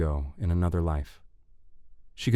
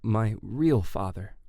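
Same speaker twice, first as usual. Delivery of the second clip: slow, higher, measured asking; drawn-out, tentative question; careful question; 4 semitones higher, slower than usual. A narrator's reading. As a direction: choose each word with care. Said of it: "choosing her words carefully".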